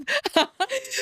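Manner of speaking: short, high, bright burst of laughter. Real, not acted. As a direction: laughs at herself, high-pitched. Laughing about her monthly cycle.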